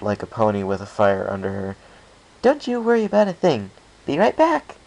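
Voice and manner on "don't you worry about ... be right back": high and loud, bursting with energy; eager excitement. Acted. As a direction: zoom off to help, thrilled. Pinkie dashing off eagerly.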